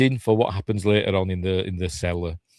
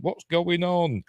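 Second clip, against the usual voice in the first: mock bewilderment; 9 semitones higher, slower than usual. Voices characters' bewilderment at noise.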